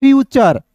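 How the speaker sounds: फ्यूचर with a "char" ending; demonstrated pronunciation. Shown as a demonstration. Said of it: "क्या पढ़ते हैं दोस्तों".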